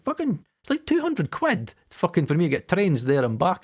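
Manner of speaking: high, put-on moan about cost; mock whiny excuse. Acts out money excuse to producer.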